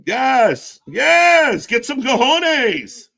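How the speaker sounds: loud, high cheering; excited yelling. Real, not acted. Hyping the wrestler on TV, loud cheering. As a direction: egg him on loudly.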